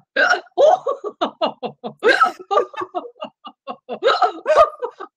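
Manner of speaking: very high laugh broken by hiccups. Shown as a demonstration. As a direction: hiccup between each laugh. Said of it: "you can also hiccup laugh".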